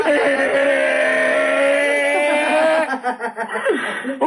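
高く伸ばした驚きの「えー」; ショックを受けて長く叫ぶ; 驚きの声. Retold or imitated. クーポンが昼だけと知る. (笑いまじりの大げさな驚き)

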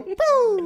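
high, loud mock boo; playful boo. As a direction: pop out a silly boo. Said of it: "and just go".

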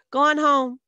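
loud, high send-off; bossy order. A narrator's reading. Older girl sending Tommy home, loud bossy order. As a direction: order a little kid away.

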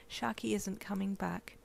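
whisper. Acted. Mother whispering bad news.

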